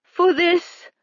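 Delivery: high, menacing queen's voice; high wicked queen; evil queen. A narrator's reading. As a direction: pronounce judgment as a sorceress. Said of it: "the queen continued".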